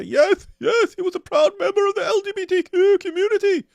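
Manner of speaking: high, loud, gushing mock proclamation; mock proud announcement. Mocking Muslims outing the prophet, high gushing proclamation. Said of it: "Muslims can ... say".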